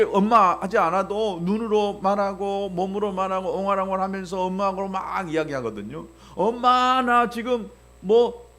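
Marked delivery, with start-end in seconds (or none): 6.3-8.5 put-on high, slow whining child voice; whiny child. Pastor mimicking a crying child asking mom for food. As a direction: whine like a hungry little kid. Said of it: "하면서 막 울면서".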